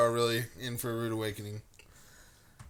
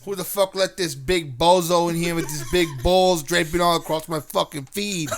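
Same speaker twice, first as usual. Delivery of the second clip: mock Boston complaint; 3 semitones higher, 5 dB louder than usual. Boston bit.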